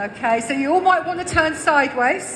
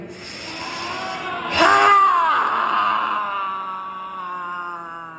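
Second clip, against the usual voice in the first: dragon roar; 5 semitones lower than usual. Real, not acted. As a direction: let out a big dragon roar. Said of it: "Let's have a dragon roar all together".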